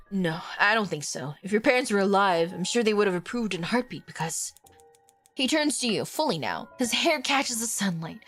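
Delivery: ordinary delivery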